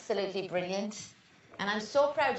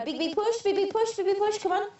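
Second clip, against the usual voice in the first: higher, rising coaching urge; urging push; 8 semitones higher than usual. Real, not acted. Midwife urging the mother in labour, higher and rising. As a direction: spur her on through the push.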